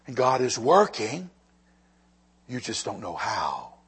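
usual speaking voice

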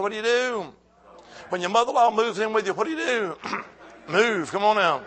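rally the church with each question; full-force preaching. Acted.

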